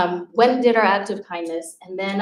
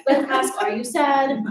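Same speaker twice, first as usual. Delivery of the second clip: exasperated teacher; 6 semitones higher than usual. Retold or imitated. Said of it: "I said".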